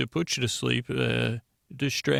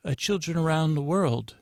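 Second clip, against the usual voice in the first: lightly put-on higher voice; institute receptionist; 4 semitones higher than usual. Institute staffer explains to doctor, lightly acted higher voice. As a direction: answer in a slightly higher staffer voice.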